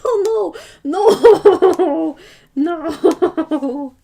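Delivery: high, loud "no" through laughter; real laughter breaking up each word; laughing protest. Real, not acted. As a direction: laugh helplessly while crying out. Reacts to buddy Steve's name joke.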